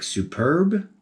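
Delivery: drawn-out, rising "superb"; sarcastic. Real, not acted. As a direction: say superb with mock praise. Mocking the prequel dialogue.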